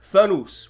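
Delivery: "Thanos" said as "thuh-NOOS"; mispronounced Thanos. Retold or imitated. Imitating how Jon Snow's actor says it, "Thanos" as thuh-NOOS. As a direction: imitate a wrong "Thanos", oo in the last syllable. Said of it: "he says Thanos wrong".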